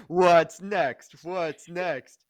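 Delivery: high-pitched, hammed-up questioning; mock exclamation. Joking about endless franchise spinoffs, high mock exclamation. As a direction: pitch it up high, starting loud.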